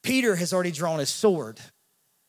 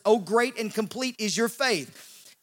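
slow, measured declaration; Jesus blessing the woman slowly. Retold or imitated. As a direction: savor each word of the blessing.

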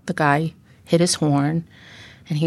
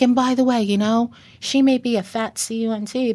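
imitated taunting; 6 semitones higher than usual. Retelling his berating of her.

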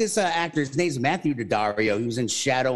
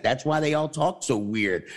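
ordinary delivery

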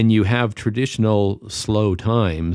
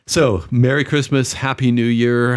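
radio announcer voice; 5 semitones higher, 5 dB louder than usual. Acted. Radio sign-off greetings.

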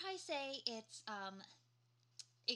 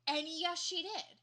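mock triumphant; 7 semitones higher, 8 dB louder, much slower than usual. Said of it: "where I was like".

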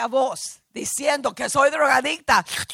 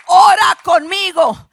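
loud, slow, higher command; commanding call to prayer; 5 semitones higher, 10 dB louder, slower than usual. Retold or imitated. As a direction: boom it out as a solemn instruction. Retold doctor commanding prayer.

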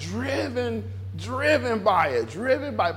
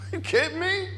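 ordinary delivery

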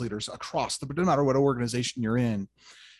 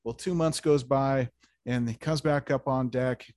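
usual speaking voice

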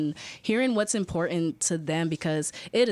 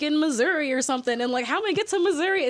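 much higher, anxious rushed quote; retold worry; 9 semitones higher, 4 dB louder, faster than usual. Classmate who can't reach Missouri, anxious high fretting. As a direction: voice a stranded classmate's panic.

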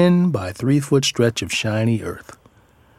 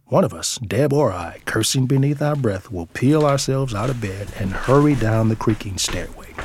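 ordinary delivery

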